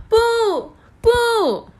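very high, loud cry of no; anguished scream. A narrator's reading. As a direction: scream it in despair. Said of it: "公主大叫".

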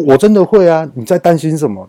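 imitating a defensive loud reply; retold loud retort. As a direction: fire it back defensively, loud. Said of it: "他突然这样很大声地跟我讲".

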